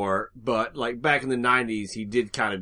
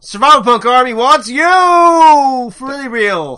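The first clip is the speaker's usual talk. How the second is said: loud, high promo call; announcer shout; 12 semitones higher, 13 dB louder than usual. Acted. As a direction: pitch it like a recruiter.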